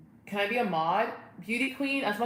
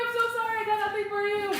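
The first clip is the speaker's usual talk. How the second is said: very high squealed apology; squealed pet talk; faster than usual. Real, not acted. No treat for the dog, squealed apology.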